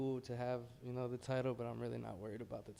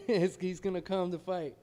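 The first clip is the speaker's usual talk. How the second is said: laughing and louder; 7 semitones higher, 10 dB louder than usual. Real, not acted. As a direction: laugh through the words. Laughs while answering interviewer.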